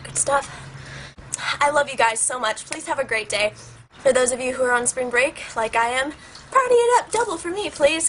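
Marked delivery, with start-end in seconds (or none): 0.0-0.6 whispered aside. Real, not acted. Aside after drink joke. 6.5-8.1 excited. Real, not acted. Excited sign-off.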